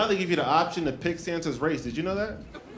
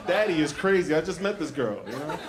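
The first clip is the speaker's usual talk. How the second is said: ordinary delivery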